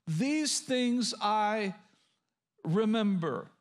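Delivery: drawn out, pausing before "remember"; slow emphatic quotation. Shown as a demonstration. Preacher repeats the verse for listeners to underline, slowly and deliberately. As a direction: stretch "These things I", pause, then land "remember".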